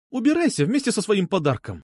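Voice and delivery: high-pitched, fed-up order; irritated dismissal. A narrator's reading. Girl ordering suitor out with his gift, irritated.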